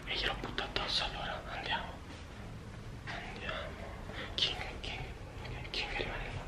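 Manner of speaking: quick hushed whisper. Real, not acted. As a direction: whisper fast, keep it secret. Prank prep, friend asleep.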